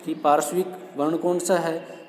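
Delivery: normal talking voice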